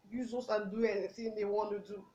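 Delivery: low, soft, sunken voice; subdued. Acted. Survivor on strangers abusing children, subdued and quiet. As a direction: drop low and quiet, as if defeated. Sinks, then repeats it with force.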